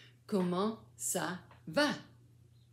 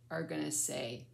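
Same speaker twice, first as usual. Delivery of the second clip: usual speaking voice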